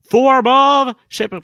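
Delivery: high, loud, drawn-out battle cry; imitated superhero yell. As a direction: yell it like a cartoon transformation call. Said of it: "us yelling out".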